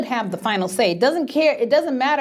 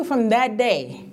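ordinary talk, nothing marked

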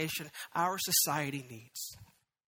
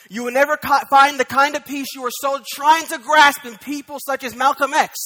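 much louder, higher, driving pulpit voice; full force preaching; 6 semitones higher, 12 dB louder than usual. Acted. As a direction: hammer it home to the congregation.

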